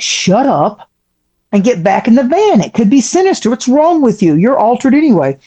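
lightly voiced exasperated scolding of a stoned friend; mock scolding. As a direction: snap her back into the van. Scolding high friend back to van.

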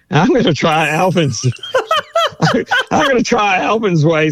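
laughing through the words, rising high; breaking into a high laugh mid-sentence. Real, not acted. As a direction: giggle out the plan. Laughing at own plan.

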